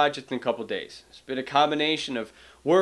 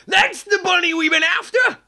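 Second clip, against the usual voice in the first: much higher, louder rooster father triumphant; 7 dB louder than usual. Acted. Father Rooster spotting the bunny. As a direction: crow it like you caught him. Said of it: "let me give that line".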